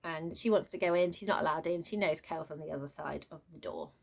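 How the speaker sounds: ordinary talk, nothing marked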